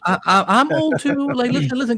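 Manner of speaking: high, loud mock protest; playful protest. Real, not acted. Host protesting he is old too, high and loud. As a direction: squeal it out in jest. Joking about being old.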